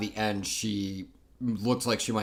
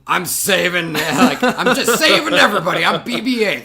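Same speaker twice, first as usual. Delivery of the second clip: loud, high, laughing; excited shout; 9 semitones higher, 10 dB louder than usual. Pretend. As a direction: yell it like a triumphant hero. Playing BB-8, loud.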